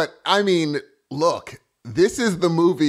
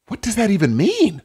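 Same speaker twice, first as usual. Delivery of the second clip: ordinary delivery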